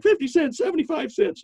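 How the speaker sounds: high, eager guessing voice; mock employee guesses. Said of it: "they'll be like".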